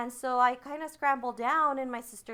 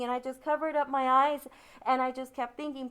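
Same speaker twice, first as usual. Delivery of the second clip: usual speaking voice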